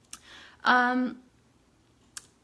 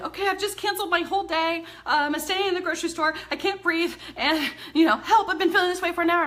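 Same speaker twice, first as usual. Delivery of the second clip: high, rushed, breathless imitation of a panic attack; panicked caller; 7 semitones higher, 5 dB louder than usual. Client calling in a panic, voiced high and frantic. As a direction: mimic a frantic client gasping for help. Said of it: "call me in complete panic attacks".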